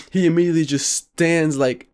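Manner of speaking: ordinary talk, nothing marked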